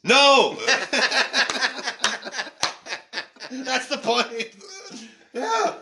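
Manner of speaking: bursting out laughing mid-answer. Real, not acted. Laughing while telling his co-host about the letter.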